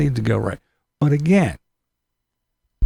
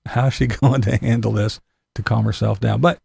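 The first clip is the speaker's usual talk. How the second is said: normal talking voice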